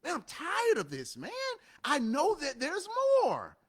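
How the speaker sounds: a lightly voiced worker sick of his job; fed-up worker. Worker looking around at his job, lightly voiced and fed up. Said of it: "you're like".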